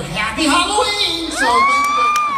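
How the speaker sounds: high, loud festive yell; comic yelling a holiday goodbye; shouted farewell. Real, not acted. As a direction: shout it out to the crowd. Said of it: "shouted sign-off".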